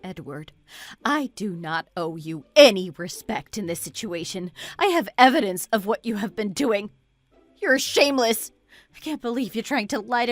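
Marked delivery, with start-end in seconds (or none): 0.0-4.5 low, controlled rebuke; contained anger. Acted. Wife rebukes husband for disrespect.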